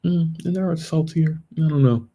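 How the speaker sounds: high, prissy busybody voice; pry like a nosy neighbor; nosy mock voice. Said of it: "just to see, is it".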